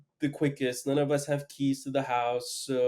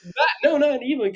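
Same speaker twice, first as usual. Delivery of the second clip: laughing; 12 semitones higher, 7 dB louder than usual. Real, not acted. Teller laughs at listener's remark.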